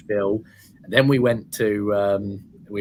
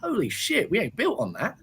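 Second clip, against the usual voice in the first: jumps much higher in pitch, a mock gasp of disbelief; mock amazement; 11 semitones higher than usual. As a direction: play a developer who can't believe an empty field. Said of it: "someone goes".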